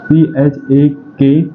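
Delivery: each letter spelled slowly and separately; spelling it out. Shown as a demonstration. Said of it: "गलत लिख दिया मैंने". (धीरे-धीरे अक्षर-अक्षर spelling)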